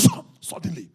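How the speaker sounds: high, very loud dramatic burst; explosive outburst. Acted. Preacher tells how the heavens opened, explosive shout. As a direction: burst in at full volume. Dramatises the sudden coming of power.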